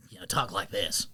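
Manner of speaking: low, soft put-on Mordecai. Co-host praises his Mordecai, low soft put-on voice. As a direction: sink low like the old trainer. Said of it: "That's a good Mordecai".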